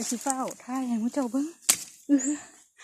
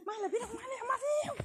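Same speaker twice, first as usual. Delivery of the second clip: excited urging; 9 semitones higher, 7 dB quieter than usual. Real, not acted. Urging viewers to come see.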